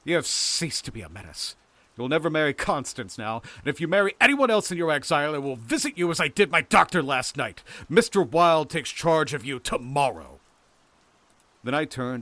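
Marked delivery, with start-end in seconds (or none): 0.0-10.4 raised voice building louder and higher; loud, high, gloating menace; cried threat. A narrator's reading. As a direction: threaten a beaten rival, gathering force. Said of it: "I cried to Lewis".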